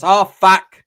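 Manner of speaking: loud, stretched-out curse; exasperated outburst. Retold or imitated. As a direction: blurt it out, fed up at another visitor. Aunt exasperated at door knock.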